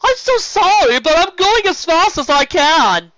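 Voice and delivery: high, loud exasperated yell; strained shout. Acted. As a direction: shout back while straining. Steven straining.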